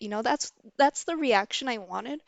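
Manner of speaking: ordinary talk, nothing marked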